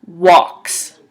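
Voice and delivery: walks with a silent L, wɑks; teacher's clear walks; careful pronunciation. Shown as a demonstration. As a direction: model walks slowly, L left out. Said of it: "you would say it like this".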